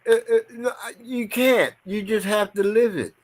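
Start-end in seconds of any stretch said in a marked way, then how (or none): none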